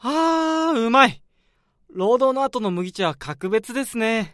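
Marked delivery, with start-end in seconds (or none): 0.0-1.2 a high, drawn-out ahh of relief, then a louder umai; satisfied sigh. Acted. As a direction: savour the first gulp after work. After drinking cold barley tea. (麦茶を飲んだ後の満足げなため息)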